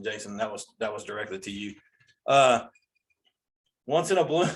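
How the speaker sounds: usual speaking voice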